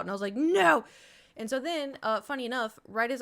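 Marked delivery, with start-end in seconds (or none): 0.3-0.8 high, loud NO; shrill no. Retold or imitated. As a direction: refuse it loudly. Retold refusal to comfort.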